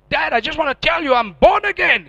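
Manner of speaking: high, loud joyful news; eager announcement. Retold or imitated. Telling his father on the phone he's born again, high and eager. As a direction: blurt it out to Dad.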